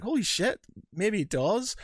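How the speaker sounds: suddenly believing it; surprised realization. Retold or imitated. Retold realization.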